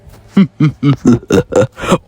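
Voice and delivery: loud booming villain cackle; evil laugh. Acted. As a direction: laugh like a triumphant overlord.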